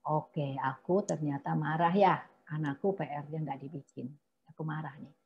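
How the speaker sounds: name your anger under your breath; quiet self-talk. Shown as a demonstration. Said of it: "analisa situasinya".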